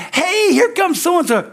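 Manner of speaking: high, slow, delighted hello; happy greeting. Retold or imitated. Church member glad to see a friend arrive, high and delighted. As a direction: greet them like you're thrilled they came. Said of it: "Do they say".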